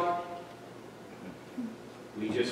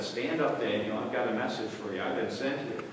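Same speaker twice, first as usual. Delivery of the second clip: lower, softer and fast, close to him; gentle angel; 9 semitones lower, 10 dB quieter than usual. Acted. Gabriel to Daniel at his side, softly and quickly. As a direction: hand on the shoulder, quietly reassuring. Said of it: "touched him on the shoulder and said".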